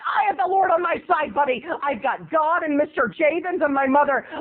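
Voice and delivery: high, self-righteous retort; hammed-up indignant boy; indignant kid. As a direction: declare it with mock righteous defiance. Retold self-righteous kid arguing back.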